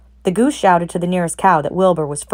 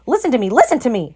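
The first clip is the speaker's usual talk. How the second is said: mild scream; 6 semitones higher, faster than usual. A narrator's reading. Goose screaming for Wilbur's attention, mildly. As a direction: demand attention. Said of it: "screamed the goose".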